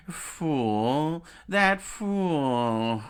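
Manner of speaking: loud mocking sneer; villain mocking Luffy as a fool; sneering laugh. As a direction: sneer down at them.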